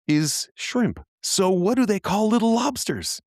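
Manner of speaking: baffled, asking out loud; puzzled high question. Real, not acted. Co-host puzzling over words, baffled question.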